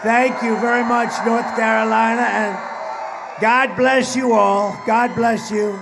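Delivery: high, loud, rousing farewell; loud sign-off. Real, not acted. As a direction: send the crowd off roaring. Rally sign-off.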